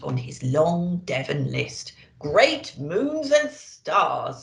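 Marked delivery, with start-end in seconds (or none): 2.2-4.4 put-on slow, weary sigh of an old man; sighing Santa. A narrator's reading. Santa sighs wearily after a long night of deliveries. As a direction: let the words sag with tiredness. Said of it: "sighed Santa".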